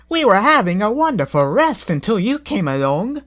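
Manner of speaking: high, prim coach voice; a snooty coach annoyed at being woken; haughty coach. A narrator's reading. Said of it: "said the lean coach".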